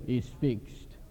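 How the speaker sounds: flat stoic pilot, two clipped words. Retold or imitated. Pilot curtly answers the joking passenger, stoic and deadpan. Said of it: "very stoically just says".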